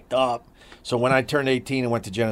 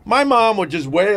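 ordinary talk

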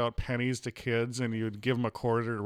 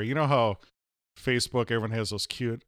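ordinary delivery

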